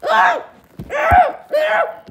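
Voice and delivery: short, very high falsetto cries; high cartoon screams of distress; squashed yelps. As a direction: yelp as if being crushed. Parent squashed by heavy son.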